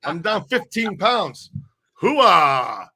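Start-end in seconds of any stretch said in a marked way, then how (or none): 1.9-2.9 loud military hoo-ah cheer; loud gung-ho soldier. Acted. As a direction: bark it like a pumped-up soldier. Bragging about losing fifteen pounds.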